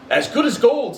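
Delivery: high, bright praise; proud father. Acted. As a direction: beam about his son. Bob proud.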